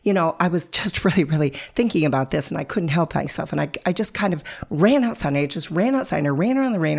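rapid, breathless run-on chatter; rushed fast talk. Shown as a demonstration. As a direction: gabble it fast to rev yourself up. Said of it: "I'm gonna talk like this".

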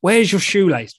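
imitating partner's astonishment; incredulous. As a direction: partner quoted in loud disbelief. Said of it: "she's saying".

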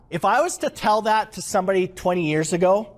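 ordinary talk, nothing marked

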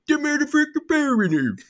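high, mock-dramatic funny voice; silly voice. Father to kids in the blackout, high silly voice. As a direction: ham up the power cut. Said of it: "I just kept going".

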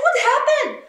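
high, loud, desperate retold cry; urgent demand. Mother questioning nanny on the phone, urgent demand. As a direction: demand an answer in alarm.

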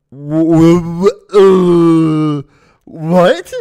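high, loud stammering what; stunned stammer. Retold or imitated. Reacting to cop's arrest threat, shocked stammer. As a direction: stutter in disbelief, voice rising.

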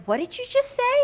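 high, baffled mock question; puzzled friends. French friends confused by a German word, mock baffled and high. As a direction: play the confused friend hearing a stray word. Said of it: "and they're like".

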